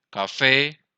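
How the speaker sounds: "café" with a punched final "fé"; "café", loud emphatic second syllable; stress on last syllable. Shown as a demonstration. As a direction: hit the "fé" of "café". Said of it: "tiene el acento en la última sílaba".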